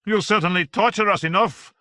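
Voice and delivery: needling back at Harry; Voltaire countering. A narrator's reading. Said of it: "Voltaire countered".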